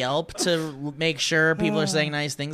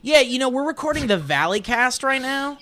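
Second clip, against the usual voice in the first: on the phone; 7 semitones higher than usual. Real, not acted. Talking on phone, raised.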